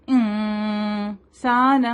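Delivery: انسان very slow with nasal hidden noon. Shown as a demonstration. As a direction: draw out انسان, tongue held back. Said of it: "تھوڑا پیچھے رہتی ہے". (انسان اخفا کے ساتھ)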